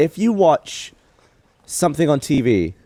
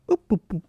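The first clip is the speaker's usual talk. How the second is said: higher, soft little boops; short, neat boops mimicking British speech; British accent sound effect; 7 semitones higher, 7 dB quieter, faster than usual. Shown as a demonstration. Demonstrating how British accents sound, neat boops. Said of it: "a British accent would be like".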